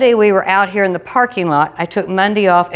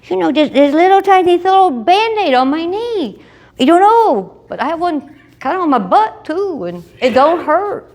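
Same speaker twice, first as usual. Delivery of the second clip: high playful boast; 10 semitones higher than usual. Retold or imitated. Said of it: "And I said".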